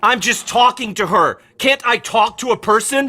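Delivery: loud, high, defensive yell; yelling back at his girlfriend, loud and high; angry shouting. Acted. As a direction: shout him down, outraged. Boyfriend defending himself in bar fight.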